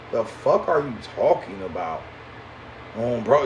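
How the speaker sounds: normal talking voice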